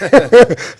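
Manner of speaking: short high real laugh; a real laugh at being the oldest of four. Real, not acted. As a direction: laugh at your own joke. Laughs at Superman complex.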